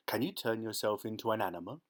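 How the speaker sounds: ordinary delivery, nothing marked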